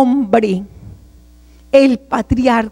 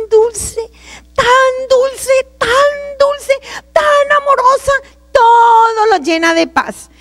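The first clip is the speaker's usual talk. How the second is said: very high, drawn-out wonder; overcome with emotion; 11 semitones higher, slower than usual. Real, not acted. Testifying about a voice so sweet, overcome. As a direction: let the pitch soar and stretch the words.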